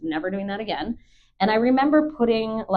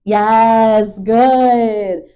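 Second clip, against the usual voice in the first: big, loud and higher praise for the dog; 3 semitones higher, 12 dB louder than usual. Real, not acted. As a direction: reward him with a loud cheer.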